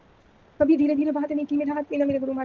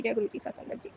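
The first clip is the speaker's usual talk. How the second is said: whispered; 7 dB quieter than usual. Real, not acted. Chant fading at prayer end.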